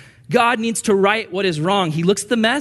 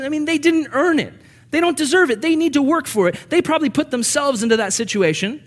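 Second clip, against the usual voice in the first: mocking objectors; 9 semitones higher, 4 dB louder than usual.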